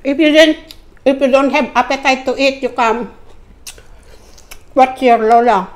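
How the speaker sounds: talking between bites; chewing while talking. Real, not acted. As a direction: mouth partly full of food. Seen 3: Face clear; hand to mouth at 4.2, chewing mouth throughout.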